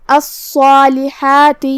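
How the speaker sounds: الصالحات slow with a heavy full-mouthed saad and long madd. Shown as a demonstration. Teacher demonstrating tafkheem on الصالحات, slow and heavy. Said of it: "تفخیم سے بھی، مطلب بھاری آواز میں".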